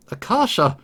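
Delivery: alarmed cry. Acted. Friend collapses after fall.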